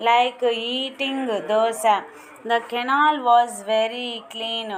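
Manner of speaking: each English word spaced out slowly for pupils to copy; slow dictation reading. Shown as a demonstration. Teacher reading answers to her class at slow dictation pace. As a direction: read it at dictation speed, word by word.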